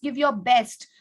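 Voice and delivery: ordinary delivery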